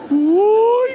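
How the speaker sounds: grito agudo de asombro; uy asombrado. Real, not acted. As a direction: exclama fuerte al ver la ola. Gritando ante las olas en video. (¡uy! gritado con asombro)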